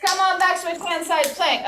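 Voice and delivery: quick, snappy yoga cues; brisk cue. Shown as a demonstration. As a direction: keep the cues moving fast. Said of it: "Don't say that too slow".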